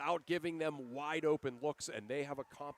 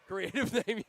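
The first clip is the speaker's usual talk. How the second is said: laughing; 5 semitones higher, 4 dB louder than usual. Real, not acted. Laughs at own play name.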